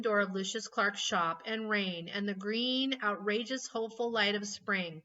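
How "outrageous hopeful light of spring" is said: usual speaking voice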